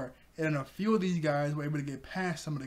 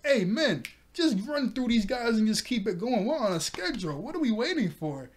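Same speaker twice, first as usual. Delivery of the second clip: bossy shout; 7 semitones higher, 4 dB louder than usual. Retold or imitated. Django ordering his crew forward.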